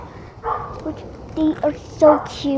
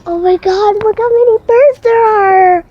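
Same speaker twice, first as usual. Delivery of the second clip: excited; 7 semitones higher, 9 dB louder than usual. Real, not acted. Excited at many birds.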